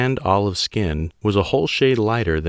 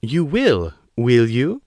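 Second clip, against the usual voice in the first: a mocking, higher woman's voice with a Chinese accent; 5 semitones higher, slower than usual. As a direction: taunt him, smiling. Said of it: "a mocking smile on her lips".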